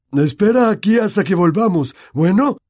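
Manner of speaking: firm instruction through the door; [name]'s character voice. Acted.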